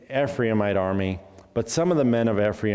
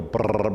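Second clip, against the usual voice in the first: long trilled brrr; buzzing demonstration trill; rolled R. Shown as a demonstration. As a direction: roll the R on the tongue or lips. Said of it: "words that have the rolling R's".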